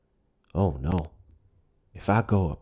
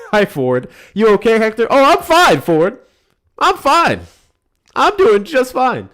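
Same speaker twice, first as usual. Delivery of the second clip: much higher and louder squeaky character voice; squeaky put-on voice; 12 dB louder than usual. Caller voice pretending all is fine to Ford. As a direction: pitch it way up like a cartoon.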